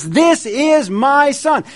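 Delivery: high, loud, slow divine voice; voice of God. Acted. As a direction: proclaim it like God from the cloud. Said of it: "heard this voice say this".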